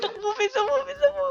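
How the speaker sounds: high frantic yelping; panicked high yelp. Real, not acted. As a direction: squeal it in panic. Screaming while fleeing.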